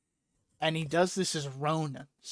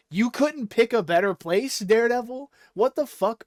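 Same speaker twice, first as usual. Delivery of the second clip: higher, louder disbelief; incredulous rant; 6 semitones higher, 6 dB louder than usual. Real, not acted. Fan ranting to friends, higher louder disbelief. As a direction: scoff at the terrible hideout. Ranting about the meeting place.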